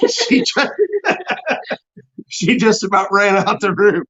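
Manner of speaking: laughing. Real, not acted. Laughing at daughter fleeing.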